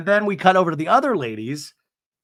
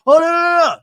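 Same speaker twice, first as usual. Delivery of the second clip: high, loud panicked wail; hammed-up cry of alarm; mock panic; 13 semitones higher, 9 dB louder, much faster than usual. Host showing how Katie isn't reacting, mock panic. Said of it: "she doesn't look panicked".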